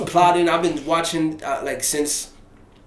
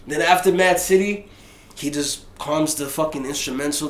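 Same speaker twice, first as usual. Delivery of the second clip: normal talking voice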